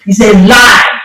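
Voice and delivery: loud sudden shout. Real, not acted. Preacher rebuking false prophets, loud shout. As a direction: shout it down as a lie.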